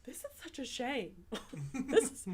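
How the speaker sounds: a mock-dainty mother, gushing through laughter; imitating mother, laughing. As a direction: imitate her fussing over a pretty dish, laughing. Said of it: "and she'd be like".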